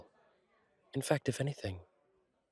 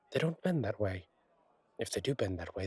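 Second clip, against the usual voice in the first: ordinary talk, nothing marked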